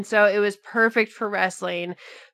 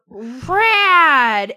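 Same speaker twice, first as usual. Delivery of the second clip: long drawn-out rad; hammed-up approval; mock amazement; 3 semitones higher, 4 dB louder than usual. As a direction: stretch the word like a wowed nineties fan. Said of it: "everyone would be like".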